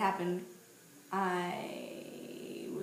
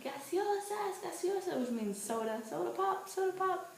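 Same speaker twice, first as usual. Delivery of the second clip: vendor's call; 10 semitones higher than usual. Retold or imitated. Imitating bus vendors calling out.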